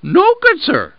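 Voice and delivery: very high little lamb voice; pleading lamb. Acted. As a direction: politely protest your innocence. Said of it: "replied the lamb".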